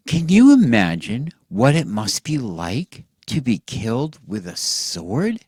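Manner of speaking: plain reading voice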